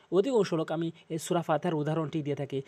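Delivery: ordinary talk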